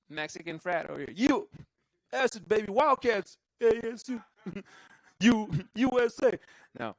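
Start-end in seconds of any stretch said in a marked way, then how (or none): 1.2-6.4 crowd hype. Real, not acted. Hyping up crowd members.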